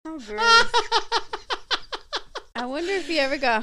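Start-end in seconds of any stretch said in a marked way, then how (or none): 0.0-0.7 high, loud laughing "how gross"; laughing disgust. Real, not acted. Reacting to the cucumber evidence.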